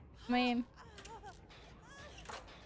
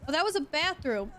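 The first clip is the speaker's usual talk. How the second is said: normal talking voice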